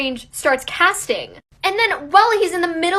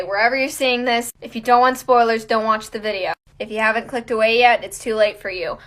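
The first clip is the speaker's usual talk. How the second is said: ordinary delivery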